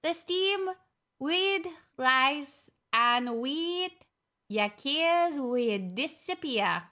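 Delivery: very slow Scottish reading; Scottish accent. Shown as a demonstration. Streamer reading the wee book to viewers, Scottish accent. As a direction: draw the words out in a put-on Scottish accent. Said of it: "would you like my Scottish accent?".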